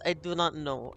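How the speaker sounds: put-on a light playful Russian accent with dropped articles; a hint of a Russian accent, half-joking. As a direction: speak broken English like a hammed-up Russian. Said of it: "Why am I doing Russian voice?".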